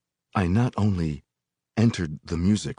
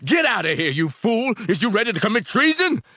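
high, loud trombone-like scream; screamed rebuke; 6 dB louder than usual. A narrator's reading. Voice screams at the listener to leave, screaming. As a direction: scream it at an intruder. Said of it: "screamed at me".

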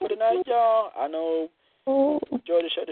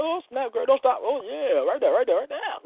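high, eager moaning man; imitating her man urging her on; man in ecstasy; 10 semitones higher than usual.

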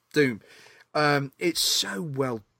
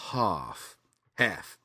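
deadpan; 2 semitones lower, 3 dB quieter, much slower than usual. Retold or imitated. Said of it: "it's so deadpan every time".

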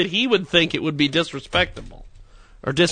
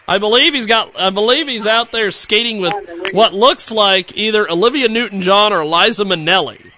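normal talking voice

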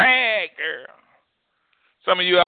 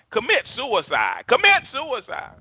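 slow, high-pitched holler, rising on the repeat; 10 semitones higher than usual. Acted. Flesh urging its owner to self-destruction, hollering. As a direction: holler it slowly, climbing much higher. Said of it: "Flesh is hollering".

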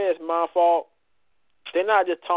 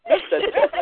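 incredulous; 13 semitones higher than usual. Real, not acted. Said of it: "repeating the nickname".

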